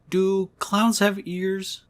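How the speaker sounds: put-on high, very slow little-girl musing; dreamy kid voice. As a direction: float the question like a spaced-out child. Dreamy little daughter zoning out.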